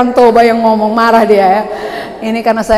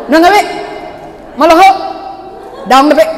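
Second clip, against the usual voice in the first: Toba Batak accent; 5 semitones higher, faster than usual. Shown as a demonstration. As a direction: bark them short, Toba style. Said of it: "simple aja dia".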